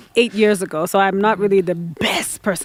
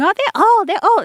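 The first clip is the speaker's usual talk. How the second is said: imitating a tactless remark, much higher; mock dismay; 11 semitones higher, 3 dB louder than usual. Quoting people who call late forties old, high mocking voice. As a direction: parrot it in a high, exclaiming voice.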